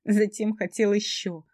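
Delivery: slow, pained, rising; tearful outburst. A narrator's reading. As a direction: cry it out slowly. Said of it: "всхлипывая, шептала я".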